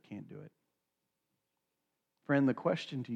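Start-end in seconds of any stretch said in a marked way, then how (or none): 0.1-0.5 soft, barely voiced refusal; quiet refusal. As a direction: let it fall away to almost nothing. Rich young ruler refuses Jesus.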